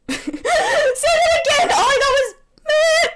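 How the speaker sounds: high, laughing shout; shrieking with laughter. Real, not acted. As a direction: cry it out through the laughter.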